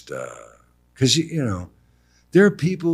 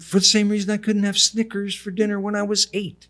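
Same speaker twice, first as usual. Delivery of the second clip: mock lecture; 11 semitones higher than usual. Son arguing with mother.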